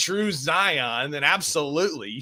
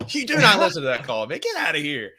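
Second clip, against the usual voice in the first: normal talking voice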